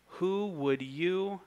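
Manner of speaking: slow, high, drawn-out mocking question; sarcastic. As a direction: stretch it out high, dripping with sarcasm. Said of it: "the sarcasm here".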